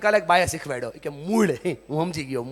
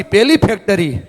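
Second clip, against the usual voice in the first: loudly; 4 semitones higher, 8 dB louder than usual. Shown as a demonstration. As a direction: call it out louder, rallying the crowd. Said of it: "જોરથી".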